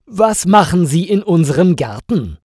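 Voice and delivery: indignant, loud challenge to an intruder; angry. A narrator's reading. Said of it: "fragt Alina wütend".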